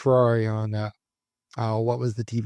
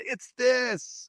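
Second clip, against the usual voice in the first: mock yelling; 12 semitones higher than usual. Host imitating viewers who know the answer, mock yell. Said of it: "gonna be yelling at the screen".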